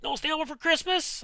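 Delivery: high-pitched wife impression; mock wife voice. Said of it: "the wife's like".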